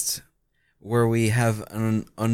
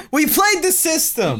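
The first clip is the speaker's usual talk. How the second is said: high laughing repeat; 6 dB louder than usual. Real, not acted. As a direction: laugh the line out, pitched up. Laughing repeat of own line.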